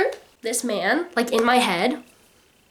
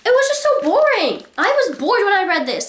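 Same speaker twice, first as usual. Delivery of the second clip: exasperated; 9 semitones higher than usual. Real, not acted.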